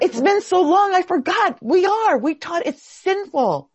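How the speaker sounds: very high, excited exclamation; amazed realization. Real, not acted. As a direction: cry it out high as it dawns on you. Realizes she had forgotten this.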